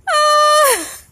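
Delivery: high delighted shriek at a card; a sudden squeal of joy; excited shriek. Real, not acted. Squealing on reading the card, surprised delight.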